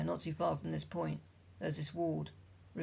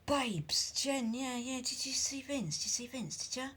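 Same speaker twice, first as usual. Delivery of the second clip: put-on Essex accent; 8 semitones higher than usual. A narrator's reading.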